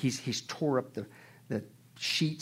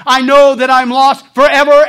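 despairing cry; 10 semitones higher, 21 dB louder than usual. Retold or imitated. Quoting a dying atheist.

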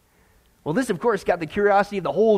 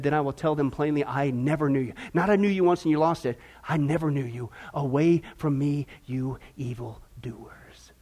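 low, grave, slowing to a whisper at the end; solemn Jesus; 8 semitones lower, 7 dB quieter than usual. Acted. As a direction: pronounce the sentence softly and finally. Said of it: "here's what Jesus said".

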